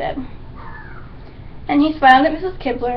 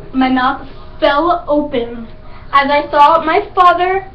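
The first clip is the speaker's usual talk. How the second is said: ordinary delivery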